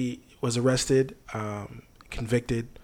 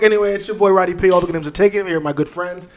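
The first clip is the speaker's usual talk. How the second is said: hype host intro; 6 semitones higher, 5 dB louder, faster than usual. Acted.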